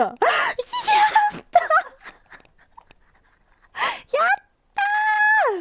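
high, loud victory shout; joyful shout. Real, not acted. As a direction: shout with real joy. Celebrating getting the hammer badge.

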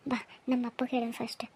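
low, half-whispered, quick suggestion; hushed urging. Acted. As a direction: urge them along under your breath. Urging sister aside amid worry. (ಪಿಸುಮಾತಿನಲ್ಲಿ, ಅವಸರದಿಂದ)

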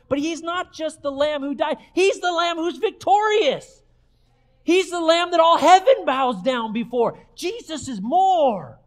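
high, loud triumphant proclamation; rousing pulpit cry; full-force preaching. Real, not acted. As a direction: build to a shout and hold the final words.

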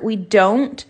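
ordinary talk

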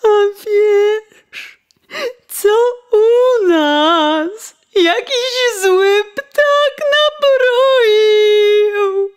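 high, loud, slow tearful wail; wife's crying lament. Acted. Wife crying to coachman about the bird. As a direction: wail the bad news at your husband.

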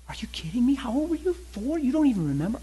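high, incredulous questioning; shocked disbelief. Acted. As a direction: act out a wife reeling at news. Acting out wife's shocked questioning.

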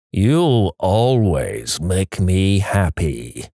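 slow, low, warped toy voice; low warped hoarse voice. Retold or imitated. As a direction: drag it out like a dying toy. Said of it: "I hear a low, slow, warped, hoarse voice say".

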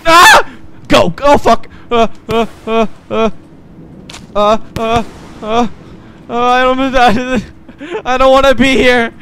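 high, loud panicked stammer. Real, not acted. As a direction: flee in a panic. Panicked flight in game.

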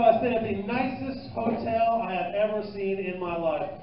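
ordinary talk, nothing marked

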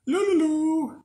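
high silly loo loo loo; mock loony warble; squirrely sing-song. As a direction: toss off a high daffy trill. Mocking squirrely mind.

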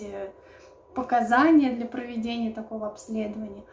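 normal talking voice

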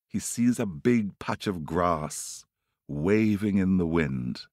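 ordinary delivery

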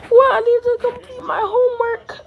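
high, fast whiny outburst; dismayed. Pretend. Kid remembering homework, dismayed. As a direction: protest in acted dismay.

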